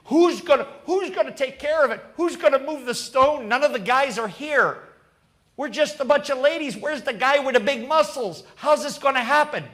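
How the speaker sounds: high, rapid worried squabbling; bickering women. Retold or imitated. Women at the tomb fretting over the stone, bickering. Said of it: "They're arguing, just like us".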